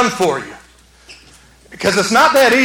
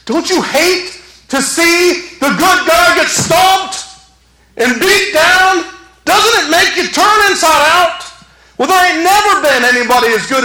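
impassioned preaching; 9 semitones higher, 4 dB louder than usual. Acted. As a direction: press the congregation with outrage. Preacher rises to full force.

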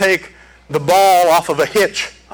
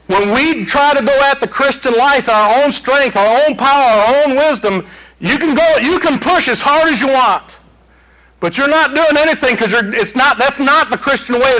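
raised, driving pulpit voice; preaching at full force; 7 semitones higher than usual. Acted. Preacher to congregation, high driving preaching. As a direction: preach it up to the back row.

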